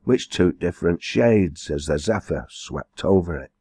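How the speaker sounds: usual speaking voice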